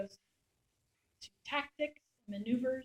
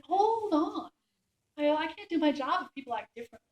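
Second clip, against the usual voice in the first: loud, higher, firm protest; retold firm objection; 6 semitones higher, 7 dB louder than usual. Reporter objecting to the first sergeant, loud. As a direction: push back, raising the voice.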